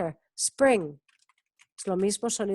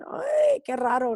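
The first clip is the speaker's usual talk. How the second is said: puzzled students; 3 semitones higher, slower than usual. Retold or imitated. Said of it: "siempre los alumnos dicen". (alumno extrañado, imitado con un "ay" agudo)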